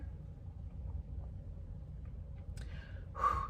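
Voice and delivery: long, voiceless exhale; long heavy sigh. Real, not acted. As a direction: breathe out slowly to regain composure. Long breath amid rising emotion.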